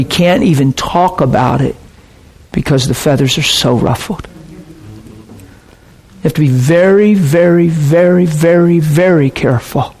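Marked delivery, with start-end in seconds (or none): 6.5-9.9 weighty stress on every "very"; emphatic repetition. Real, not acted. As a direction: stretch each "very" as a solemn warning. Preacher piles up repeated warning word.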